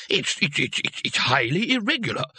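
a lightly voiced sputtering old wizard; flustered stammer. A narrator's reading. Wirt sputtering at Trumon's hired hero, mild flustered stammer. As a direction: trip over the words in outrage.

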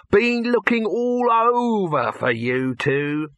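high, drawn-out huckster patter; brash showman. Acted. Dibbler greets his two actors, brash patter. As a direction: pitch it slowly and high, like a promoter.

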